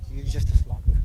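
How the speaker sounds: rough attempt at South African accent. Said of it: "That was my South African accent".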